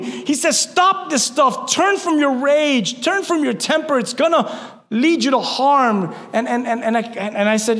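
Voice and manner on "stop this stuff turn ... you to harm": slow preaching at full force. Acted. Pastor commanding the church to stop, slow forceful preaching. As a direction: drag out each command.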